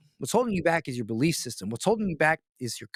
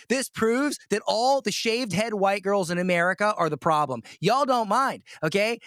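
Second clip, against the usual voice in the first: ordinary talk, nothing marked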